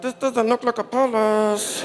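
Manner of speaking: slow, put-on doubtful voice; doubtful aside. Comic eyeing the palace, slow and doubtful.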